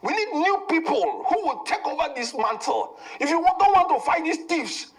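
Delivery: high-pitched, insistent political rant; real fired-up plea, pitch climbing as it builds. Real, not acted. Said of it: "you don't need to scream".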